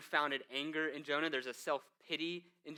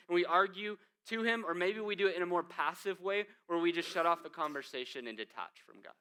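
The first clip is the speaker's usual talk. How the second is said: ordinary talk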